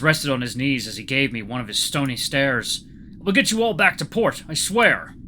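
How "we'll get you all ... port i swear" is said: put-on raised, solemn Russian-accented pledge. A narrator's reading. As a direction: swear it as a solemn promise. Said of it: "His voice was solemn".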